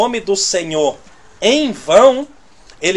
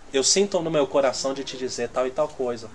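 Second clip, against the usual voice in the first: soft, careful way to share a word; gentle humble opening; 5 semitones lower, 8 dB quieter than usual. Shown as a demonstration. Preacher showing the congregation a humble way to begin. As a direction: say it softly and humbly, no pressure. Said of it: "pra não dizer o nome do Senhor em vão".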